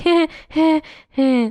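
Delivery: repeated short heh of fake laughter; nervous giggle. Receptionist unable to refuse dates, imitated awkward giggle. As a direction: imitate your own helpless little giggle. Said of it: "I'm always like".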